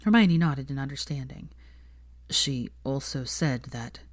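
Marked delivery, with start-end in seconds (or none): 2.3-4.0 slow, hushed and reluctant; a touch of sighing heaviness; heavy sigh. A narrator's reading. Draco reluctantly repeats his mother's words, sighing. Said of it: "he sighed heavily".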